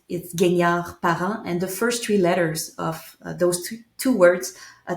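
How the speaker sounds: usual speaking voice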